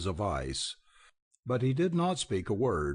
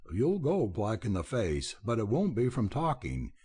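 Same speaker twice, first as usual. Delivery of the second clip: plain reading voice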